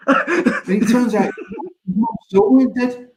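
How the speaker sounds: high, slow, laughing through the words. Real, not acted. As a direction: giggle the punchline out.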